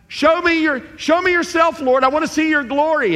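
high, loud eager plea to God; pleading Moses, high-pitched and loud. Retold or imitated. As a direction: beg to see the glory, way up high. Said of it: "Moses had asked God".

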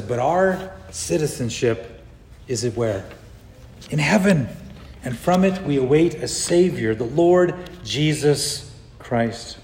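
slow, soft, reverent verse quote; solemn scripture reading. Acted. Preacher reads Philippians to the church, slow and reverent.

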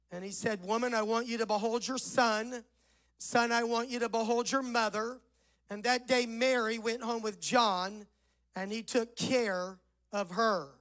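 ordinary talk, nothing marked

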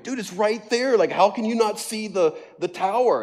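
high, incredulous shaming; mock him for missing it; shaming disbelief. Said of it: "like, shaming him".

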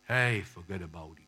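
low mock Italian-gangster voice; terrible Italian accent. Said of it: "In terrible Italian accent".

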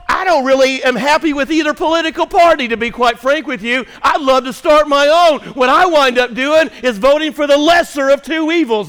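high, loud pulpit delivery; forceful high-pitched sermon voice; full-force preaching. Real, not acted. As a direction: preach it out to the whole room, building to a shout.

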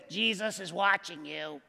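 low, inhuman talking-bird voice; eerie parrot voice. Acted. Parrot in the dark warning the robber, low and eerie. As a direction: intone it like an unseen parrot spooking a burglar. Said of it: "It doesn't even sound human".

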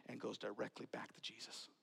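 hushed whisper. Acted. Preacher to congregation, dropping to a hushed whisper. Dramatic hush in sermon.

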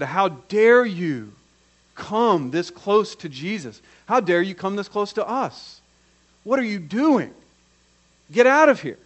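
acted outrage, building to a shout; mounting indignation, ending in a loud high order; indignant crowd.

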